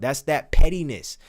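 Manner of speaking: ordinary talk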